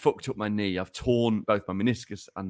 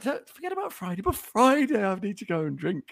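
sly inner liar; 11 semitones higher than usual. Retold or imitated. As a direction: coax like a sneaky little liar. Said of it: "little liar in my head".

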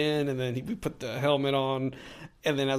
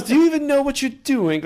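much higher and louder snide droid taunt; K-2SO taunt; 13 semitones higher, 7 dB louder than usual. Retold or imitated. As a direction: needle the player like a smug robot. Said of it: "K two S O kept giving me crap".

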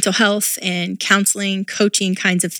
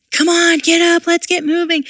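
mom-mode yelling; 10 semitones higher than usual. Retold or imitated. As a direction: rush the kids out of bed, yelling. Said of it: "in mom mode, I was yelling".

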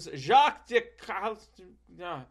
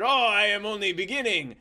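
Jacques voice; 5 semitones higher, 5 dB louder, faster than usual. Retold or imitated.

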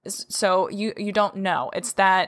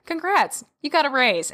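bright, much higher congratulations; cheerful boss; 7 semitones higher than usual. Retold or imitated. Boss cheerfully announcing the raise, high and bright. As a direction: beam it out like good news. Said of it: "he's like".

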